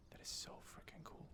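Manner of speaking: hushed, awed whisper. Real, not acted. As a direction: breathe it out in awe. Awed whisper while watching.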